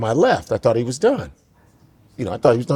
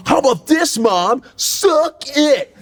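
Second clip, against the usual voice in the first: high shouted brat taunt; a high, shouting, mocking brat; bratty kid shouting; 8 semitones higher, slower than usual. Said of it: "the kid be like".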